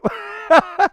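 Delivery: high burst of laughter. Real, not acted. Laughs at backward swimmer.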